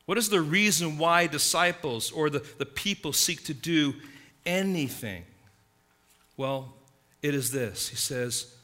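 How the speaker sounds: normal talking voice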